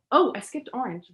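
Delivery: normal talking voice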